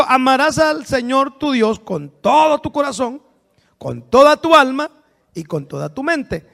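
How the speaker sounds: scripture reading. Acted. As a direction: proclaim the commandment solemnly. (proclamando la Escritura con solemnidad)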